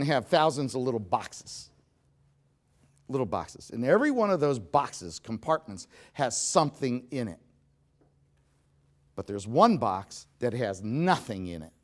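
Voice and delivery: normal talking voice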